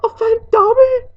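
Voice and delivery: very high, loud, tearful disbelief; overwhelmed elf wail; choked-up elf. A narrator's reading. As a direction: choke it out through tears. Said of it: "choked the elf".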